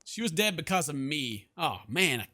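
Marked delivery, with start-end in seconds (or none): none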